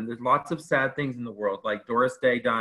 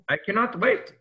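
higher-pitched, shaky attempt at Irish; attempted Irish accent; 9 semitones higher than usual. Imitating an impatient Irish drinker complaining, attempted Irish accent. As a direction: try an Irish accent, badly. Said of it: "if I can do an Irish accent".

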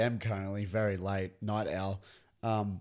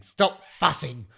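mock yell, higher and drawn out; childish yell; 5 semitones higher, 8 dB louder, slower than usual. Passenger scolding the tram, childish mock yell. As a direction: yell it slowly like a cross child. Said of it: "yelling at it like a child".

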